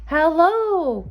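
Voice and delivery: a high, loud hello echoing back from far away. A narrator's reading. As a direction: let the hello ring back like an echo. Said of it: "came back his echo".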